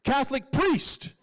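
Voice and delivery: drawn-out, high-pitched call across an office; yelling out. Retold or imitated. Walking into the chaplain's office at dawn, yelling out. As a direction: holler it slowly, stretching both words. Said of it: "I yell out".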